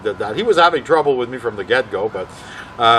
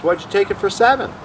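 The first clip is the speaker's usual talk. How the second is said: higher-pitched needling question; mock a colleague's decision, pitched up; ribbing; 9 semitones higher than usual. Manager questioning a colleague's booking, ribbing him. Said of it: "ribs him and says".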